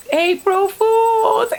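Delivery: high, slow sing-song April Fools; gleeful prank reveal. Retold or imitated.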